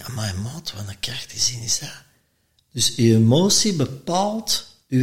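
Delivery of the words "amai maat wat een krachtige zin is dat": hushed, voiceless amazement; awed whisper. Retold or imitated. Hearing the quote at the seminar, an awed whisper. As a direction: breathe it out in awe. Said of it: "En ik denk".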